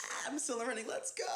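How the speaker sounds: high and loud, thrilled to still be in; excited squeal. Retold or imitated. Retelling getting another interview, a high excited squeal. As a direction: squeal it like the dream's still alive.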